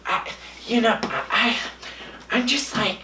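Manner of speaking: soft, high, halting celebrity voice; breathy Jennifer Coolidge impression. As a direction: imitate a dreamy, hesitant actress. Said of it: "And she'd be like".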